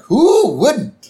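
high, loud mock wail; hammed-up gushing cartoon dad; Homer. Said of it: "Homer's like".

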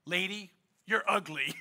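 hint of a deadpan parrot; lightly voiced parrot. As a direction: deliver the insult as a parrot, softly and dryly. Parrot repeats insult in joke.

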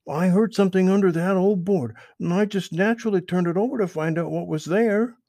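put-on high, eager young rabbit voice; Peter Rabbit voice. Peter explains to Mister Toad, high and eager. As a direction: pitch it up high like a small rabbit.

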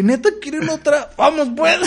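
agudo y riendo, entusiasmado; emocionado. Retold or imitated. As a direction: acepta la otra ronda con risa alegre. Aceptando otra botella riendo. (con los compas, aceptando otra botella, agudo y entre risas)

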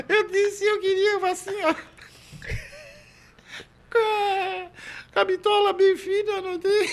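high, squeezed out through real laughter; helpless giggling squeal; laughing while talking. Real, not acted. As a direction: struggle to tell it while cracking up. Cracking up while telling own joke.

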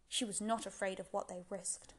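ordinary delivery

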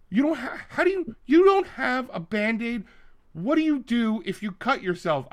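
high, indignant mock customer voice; a mock-outraged customer, high and disbelieving; indignant woman. Retold woman flipping out.

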